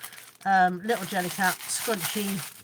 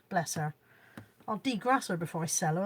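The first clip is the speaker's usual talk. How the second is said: usual speaking voice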